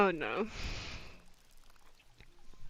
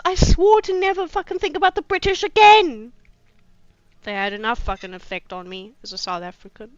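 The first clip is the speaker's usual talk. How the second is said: ordinary talk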